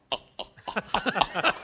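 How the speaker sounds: high, breathy mock oh-oh-oh; evasive laughing oh's. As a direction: dodge the question with light oh's. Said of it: "Mi fa così".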